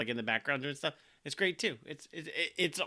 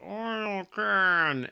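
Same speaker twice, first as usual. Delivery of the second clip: a lightly done, higher creaky Tin Man; hint of a Tin Man voice; Tin Man impression; 6 semitones higher than usual. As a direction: squeak it out like a rusty Tin Man asking for oil. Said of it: "he does a Tin Man from Wizard of Oz impression".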